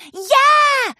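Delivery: high, loud yeah; cheer. Acted. Scootaloo agreeing, high excited shout. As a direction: chime in excitedly. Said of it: "chimed in".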